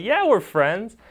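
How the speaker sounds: put-on high, loud burst of kid excitement; excited. New kid to new best friend, a high, loud excited question. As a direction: pitch it up like a thrilled kid. Kids bonding, excited quote.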